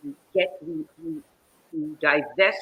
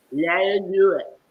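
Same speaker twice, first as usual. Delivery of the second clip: slow, labored command from a stroke survivor, imitated; Margaret Gibson; 3 semitones higher, 7 dB louder, slower than usual. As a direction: push each word out slowly and loudly. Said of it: "And from her wheelchair".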